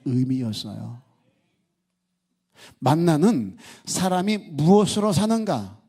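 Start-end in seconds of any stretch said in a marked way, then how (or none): 2.8-5.8 emphatic preaching question. Real, not acted. Key question of the sermon.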